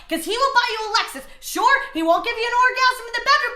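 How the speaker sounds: ordinary delivery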